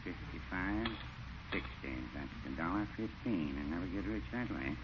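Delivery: soft mumbled apology drifting back into counting; quiet distracted count. Shopkeeper apologising to Gildersleeve, then counting the day's take softly. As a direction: murmur it to yourself over the till.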